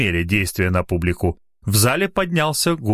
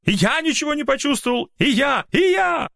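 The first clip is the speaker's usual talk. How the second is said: high, angry crowd cries; discontented shouting. Acted. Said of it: "недовольные возгласы". (недовольные выкрики)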